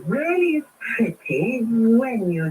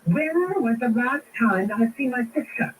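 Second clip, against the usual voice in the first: ordinary talk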